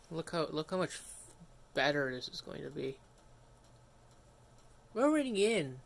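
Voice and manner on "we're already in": excited. Real, not acted. Fast reboot succeeds.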